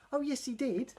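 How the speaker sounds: high, sing-song insisting; insistent high reply. Acted. A fish insisting the story is true. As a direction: chirp it back defiantly. Pantomime call-and-response.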